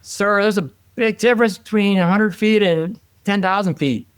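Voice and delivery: high, strained-up protest to a superior; retold yelling. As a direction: re-enact arguing back over engine noise. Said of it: "So I'm yelling".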